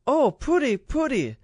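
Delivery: squealing high and loud, pooty pooty; a tiny child thrilled by pretty clothes; delighted baby-talk girl. A narrator's reading.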